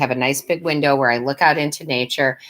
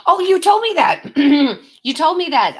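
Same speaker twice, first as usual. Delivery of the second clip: a delighted oh, of course; surprised realization; 13 semitones higher, 5 dB louder than usual. Real, not acted. To a viewer recalling the daily reading, high and surprised.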